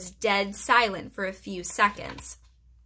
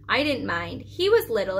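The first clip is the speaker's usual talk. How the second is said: ordinary delivery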